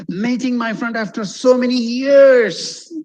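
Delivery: high, slow, "so many years" drawn out; heartfelt, sustained high pitch on "so many years"; really meaning it. Shown as a demonstration. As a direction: swell with feeling up to "years". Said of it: "show that you really mean".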